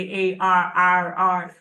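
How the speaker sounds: A R R R drawn out slowly in an imitated accent; accented spelling aloud. Lady on the recording spelling her name, accented spelling aloud. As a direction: stretch each R, mimicking a foreign speaker. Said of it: "they'll say".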